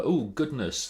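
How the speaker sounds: normal talking voice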